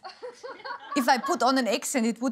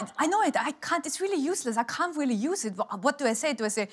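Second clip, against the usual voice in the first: ordinary delivery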